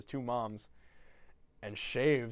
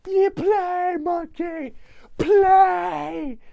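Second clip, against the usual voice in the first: very high, very loud shouted command; very high shrieking order to a toy; mock frantic yell; 13 dB louder, much slower than usual. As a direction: scream at the monkey to play. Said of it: "And he goes".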